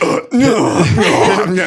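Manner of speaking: loud, high groaned noes; mock moans and groans. Podcaster re-enacting five seconds of moans and groans, loudly groaning. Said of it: "moans, groans, and burps".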